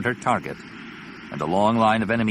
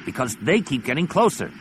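growling; 6 semitones higher than usual. Acted. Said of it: "Zek growled back".

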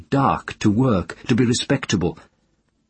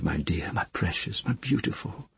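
soft, whispered endearments; whispering endearments to a frightened child; tender whisper; 9 dB quieter than usual. Acted. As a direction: whisper it close to the child's ear. Mother soothing child after promise.

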